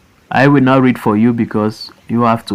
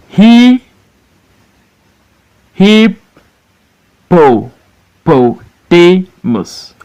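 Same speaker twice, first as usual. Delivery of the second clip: "hippopotamus" very slow, a pause between syllables; "hippopotamus" in long, separated beats; broken into syllables; 4 semitones higher, much slower than usual. Shown as a demonstration. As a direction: restart on "he", then sound out "hippopotamus" piece by piece. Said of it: "You break into syllables".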